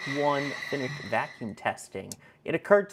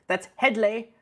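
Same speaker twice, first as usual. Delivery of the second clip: Hedley quote; 7 semitones higher, slower than usual. Retold or imitated. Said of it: "they wanted me to do the".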